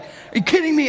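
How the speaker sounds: high-pitched exasperated outburst; mock frustration. Driver losing the game to static, mock frustration. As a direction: act out disbelief at the radio.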